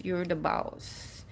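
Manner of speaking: hushed, slow affirmation; soft echo. Shown as a demonstration. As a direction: let it sink in quietly. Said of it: "take a stand".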